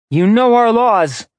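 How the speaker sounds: stern warning. Acted. Braden warns of laws.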